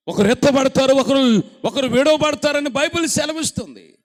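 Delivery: loud and high, driving the warning home; full-force preaching. Acted. Preacher warning the congregation, loud and high. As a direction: thunder it out from the pulpit.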